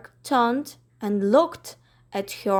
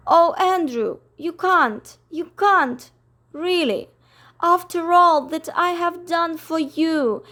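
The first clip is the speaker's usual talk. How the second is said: close to tears; 6 semitones higher than usual. Acted. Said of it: "Miss Lark was ready to cry".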